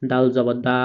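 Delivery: normal talking voice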